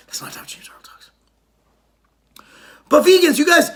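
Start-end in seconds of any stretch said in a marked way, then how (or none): 0.1-1.1 soft, breathy whisper under the breath; whispered aside. Real, not acted. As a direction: mutter it off to the side as a hush. Aside after a voice impression.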